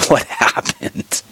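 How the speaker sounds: airy, slow, high gasp of surprise; winded little wheeze; breathless mock gasp. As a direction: act out gasping for air at altitude. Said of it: "and I'm like".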